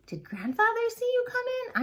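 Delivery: high, anxious question; worried. Acted. On worries about grandfather, high voice. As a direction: ask in a rush of worry.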